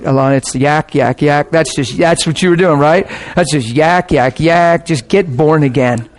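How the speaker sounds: ordinary delivery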